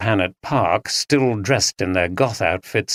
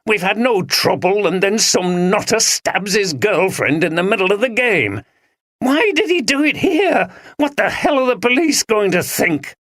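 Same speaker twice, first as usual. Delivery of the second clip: pitched higher, rising into agitated disbelief; jumpy, panicky man; 10 semitones higher than usual. Acted. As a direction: actor rattled after a murder at his workplace.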